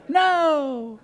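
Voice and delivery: high, drawn-out wail of "no"; hammed-up little kid pleading; mock child's cry. Child's cry on being eaten.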